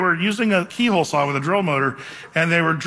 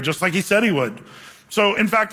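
normal talking voice

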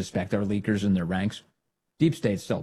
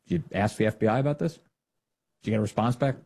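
normal talking voice

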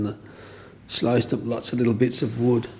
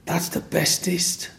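high, slow, awed praise; slow high-pitched marvelling; admiring kid; 7 semitones higher, slower than usual. Retold or imitated. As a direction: imitate a child's wonder, drawing each word out. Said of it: "And she said".

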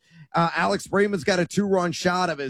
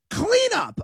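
cleanup squeaked way higher in disbelief; shocked disbelief. Real, not acted. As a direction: say cleanup like you can't believe it, voice jumping up high.

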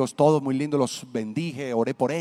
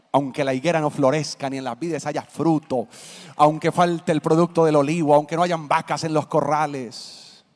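louder preaching read of scripture; 4 dB louder than usual. Acted. Pastor preaches Habakkuk before the mayor, proclaiming voice. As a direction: proclaim the verse to the crowd.

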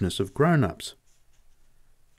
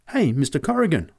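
high-pitched astonished call; startled child calling to a grown-up; 7 semitones higher, 4 dB louder than usual. A narrator's reading. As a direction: call out in sudden surprise. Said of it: "I said in astonishment".

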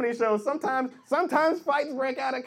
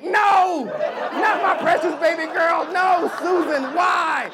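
loud, higher, hammed-up indignation; outraged father; 4 semitones higher, 6 dB louder than usual. Father refusing daughter's diabetic boyfriend, outraged shouting. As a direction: forbid it like a horrified dad.

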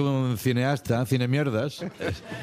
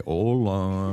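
imitated drawn-out greeting; wife mimicking hello; 3 semitones lower than usual. Husband retelling his wife copying the catchphrase, drawn-out hello. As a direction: drawl the hello like a parody. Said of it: "mi mujer ... dice".

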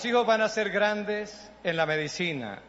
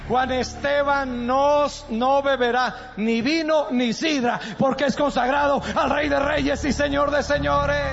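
louder, higher, slow proclaiming; fervent declaration; 10 semitones higher, 9 dB louder, slower than usual. Acted. Pastor loudly declares a blessing over the family's son. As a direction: declare it over the boy with conviction. Preaching at full force.

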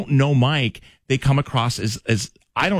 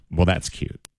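patronizing; 5 semitones lower, 4 dB quieter, slower than usual. Shown as a demonstration. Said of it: "belittling and patronizing".

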